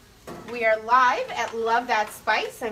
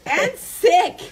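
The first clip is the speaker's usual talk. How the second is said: laughing; 8 semitones higher, 7 dB louder than usual. Real, not acted. Laughing at own slip.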